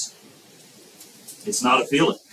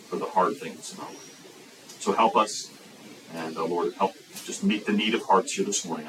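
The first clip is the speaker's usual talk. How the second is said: soft, lower prayer voice; 5 semitones lower, 6 dB quieter than usual. Acted. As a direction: pray quietly to the Lord. Leads a prayer.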